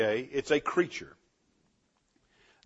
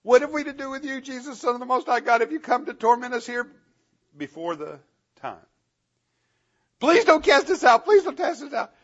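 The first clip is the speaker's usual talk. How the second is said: high, pleading demon shriek, louder; screaming demons; 11 semitones higher, 4 dB louder than usual. Acted. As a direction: beg for mercy in a shrill high demon voice. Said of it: "they start screaming".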